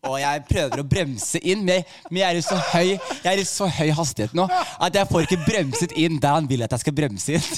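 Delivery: usual speaking voice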